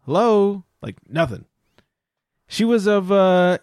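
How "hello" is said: raised calls of hello, pitched up; calling out. Retold or imitated. Calling out to the woman cutting in line, raised voice. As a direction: call out hello to get her attention. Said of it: "And I started, was like".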